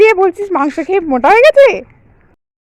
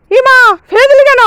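loud, high cry of dismay; shocked scolding; 7 semitones higher, 6 dB louder than usual. Acted. Child threw the treat away.

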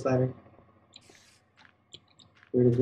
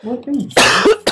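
shocked; 8 semitones higher, 10 dB louder than usual. Real, not acted. Disbelief at arrow count.

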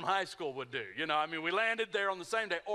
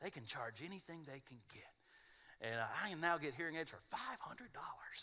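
ad pitchman; 2 semitones lower, 9 dB quieter than usual. Retold or imitated. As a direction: murmur it like the ad's announcer. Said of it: "I'm trying to reenact the whole commercial".

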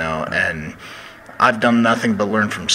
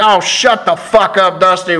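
higher, louder, slow mock grumpy reply; Doc's retort impression; 8 semitones higher, 6 dB louder than usual. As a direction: bark it back at the teasing coach. Doc snaps back at Dusty.

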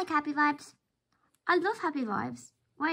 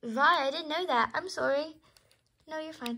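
usual speaking voice

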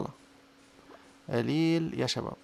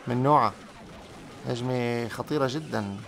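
ordinary delivery

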